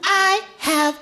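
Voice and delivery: put-on high, slow child voice; little girl voice. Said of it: "She said".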